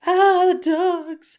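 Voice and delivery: high, slow, loud shriek; freaking out. Retold or imitated. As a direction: shriek it out in alarm. Aunt freaking out over dogs.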